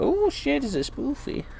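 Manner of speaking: high-pitched, cooing surprise; delighted pet talk. Real, not acted. Reader finds the dog under the chair, high cooing surprise. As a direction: squeal softly at finding a pet.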